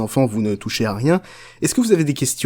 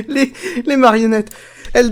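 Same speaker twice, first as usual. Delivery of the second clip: high laughter spilling into words; laughing; 12 semitones higher, 4 dB louder than usual. Real, not acted. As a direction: laugh through the words about the puppets. Laughs after the puppet show.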